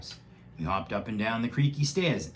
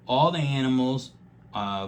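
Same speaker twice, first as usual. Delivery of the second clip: plain reading voice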